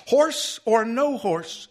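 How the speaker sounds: high, slow and haughty; stern high librarian voice; stiffened indignation. A narrator's reading. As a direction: draw it out stiffly, pitched high. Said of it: "Then she stiffened again".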